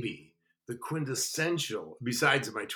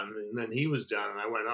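plain reading voice

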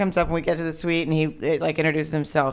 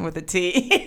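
ordinary delivery

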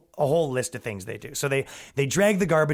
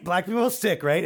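ordinary talk, nothing marked

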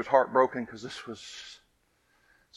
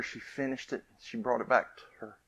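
ordinary delivery

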